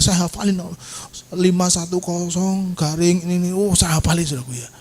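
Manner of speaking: usual speaking voice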